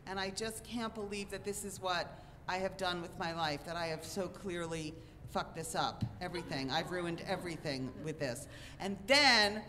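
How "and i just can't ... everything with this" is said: low, soft, sinking self-blame; retold hopelessness, voice dropped down; quiet despair. Despairing after baby's grim news.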